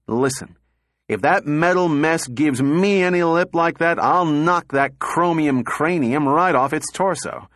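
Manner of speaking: sudden rage. A narrator's reading. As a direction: burst out angrily at someone infuriating you. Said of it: "with sudden rage".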